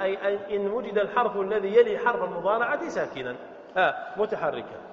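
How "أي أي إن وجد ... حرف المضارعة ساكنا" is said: ordinary delivery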